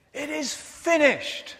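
slow, high, ringing proclamation; solemn declaration. Retold or imitated. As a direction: proclaim it slowly and fully. Jesus' last words quoted.

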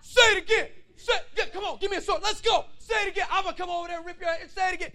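high, very loud fighting shout; David all fired up. Acted. Young David answering the giant's insult, fired-up shouting. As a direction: yell it like you're itching for a sword. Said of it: "David gets all fired up".

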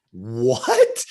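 loud, drawn-out shocked question; mock shock with a rising end; shocked mother. Mom hearing what the coach told her son, shocked. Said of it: "shocking look. She was like".

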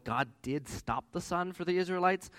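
ordinary talk

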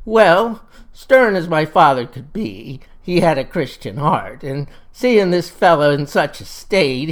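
ordinary delivery

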